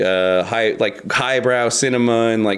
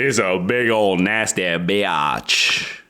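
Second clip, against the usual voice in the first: low slow growl; 5 semitones lower, much slower than usual. Acted. Low slow joke line.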